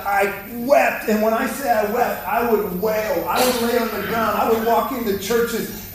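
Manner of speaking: ordinary talk, nothing marked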